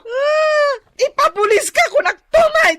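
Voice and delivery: high, loud sobbing threat; crying threat. Acted. Wife sobbing at the man who hurt her husband, loud high threat. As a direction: threaten through tears.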